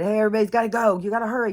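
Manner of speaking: calling everyone out of the building; urgent call out. Retold or imitated. Workers calling out evacuation.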